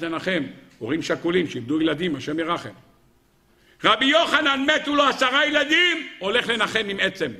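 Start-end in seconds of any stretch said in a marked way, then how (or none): 3.8-7.3 high-pitched, astonished proclamation; ringing preacher's exclamation; impassioned preaching. Acted. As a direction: cry out the loss to the congregation.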